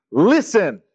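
a firm voiced command from Jesus; commanding listen. Jesus commanding the crowd, a firm call to listen. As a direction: issue it as an order, not an invitation. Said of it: "This word in Greek is a command".

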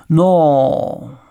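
hesitant outburst; blurted out. A narrator's reading. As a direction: blurt it loudly, then hang on it. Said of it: "vyhrkl Honza".

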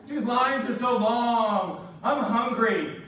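slow, drawn-out mock whine; whining kids. Kids complaining at the theme park, mock whiny. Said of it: "Whining in the wilderness".

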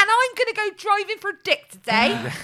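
high, loud, gushing sign-off; Sophie Anderson impression. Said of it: "I could do an impression of her".

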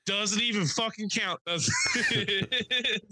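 high, laughing shout; mock callout shout. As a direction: call him out across the room. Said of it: "I'm like".

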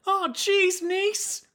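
very high put-on Chicago accent; squeaky mock-Chicago character voice. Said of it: "I got this Chicago accent".

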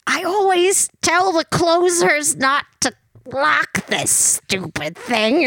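a hint of a grumpy tiny clerk; grumbling old lady. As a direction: grumble it in a small voice. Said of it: "she's like".

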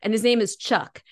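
usual speaking voice

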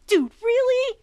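high, loud eager surprise; light up with excitement; excited. Acted. Asked to report.

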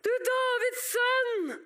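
high, slow cry to someone passing; drawn-out beggar's shout; calling out. Acted. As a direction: holler it out, stretching each word. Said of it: "han roper".

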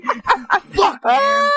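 high, laughing burst of disbelief; a sudden laughing cry; laughing exclaim. Real, not acted. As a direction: crack up and blurt it out high. Laughing outburst at the news.

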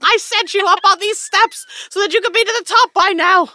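high, loud exasperated scolding; scolding shout. Acted. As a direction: berate them for dawdling. Ristos scolding the slow party.

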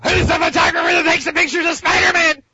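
high snarling villain shout; Green Goblin voice. Acted. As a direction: yell like a menacing comic-book villain. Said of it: "your Norman Osborn Green Goblin".

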